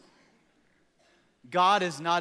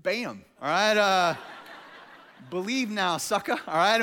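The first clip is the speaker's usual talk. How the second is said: mock gloating; 5 dB louder, slower than usual. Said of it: "I'm gonna be like".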